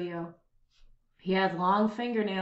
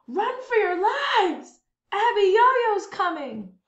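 much higher, slow, louder cry; panicked shout; 11 semitones higher, 6 dB louder, slower than usual. Acted. Townsfolk warn each other the giant is coming, panicked. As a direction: scream a warning. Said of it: "Women screamed".

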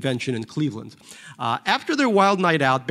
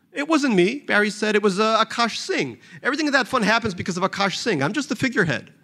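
higher, quicker whiny excuse; Barry's defensive voice; 6 semitones higher than usual. Acted. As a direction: push the blame off, pitched up.

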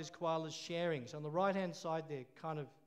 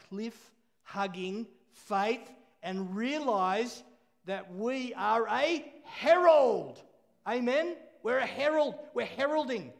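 louder, slow and ringing on herald; preaching at full force; 3 semitones higher, 8 dB louder, slower than usual. Acted. Preacher rousing the church to see themselves as heralds, loud and slow. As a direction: proclaim it like a herald. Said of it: "preaching climax".

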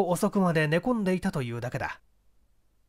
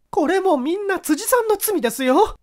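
higher and louder reproachful voice; resentful woman; 13 semitones higher, 5 dB louder than usual. Acted. Wife reproaching the reporter, higher and louder resentful voice. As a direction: throw the blame at him, aggrieved. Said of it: "と恨む".

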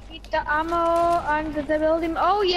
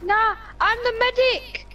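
excited; 6 semitones higher than usual. Pretend. Begging as medic.

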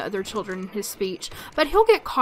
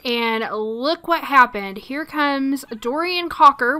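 ordinary talk, nothing marked

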